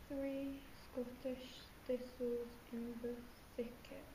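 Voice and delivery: each consonant of "three Scottish thistles in the thicket" said clearly; careful diction. Shown as a demonstration. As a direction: enunciate "thistles" and "thicket" cleanly. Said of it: "Show me as a diction exercise".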